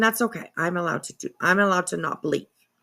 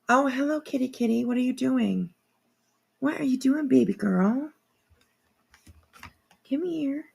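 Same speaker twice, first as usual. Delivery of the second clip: high, soft cooing at the cat; 5 semitones higher, 4 dB quieter than usual. Real, not acted. Streamer greets her cat walking by, soft cooing baby talk. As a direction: coo it like talking to a kitten.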